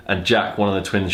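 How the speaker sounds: usual speaking voice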